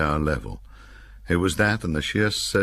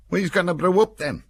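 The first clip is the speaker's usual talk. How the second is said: much higher Cumbrian voice calling out; soldier calling out; 4 dB louder than usual. A narrator's reading. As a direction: call out for someone to make the tea.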